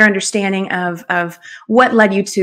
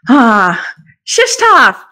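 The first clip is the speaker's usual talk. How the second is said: excited greeting; 5 dB louder, much slower than usual. Real, not acted. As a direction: greet him with delight. Host greets guest excitedly.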